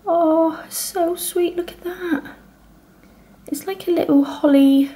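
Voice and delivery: high, delighted cooing over something cute. Real, not acted. Vlogger coos over the cute advent gift, high and delighted. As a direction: gush at the little holly in a high sweet voice.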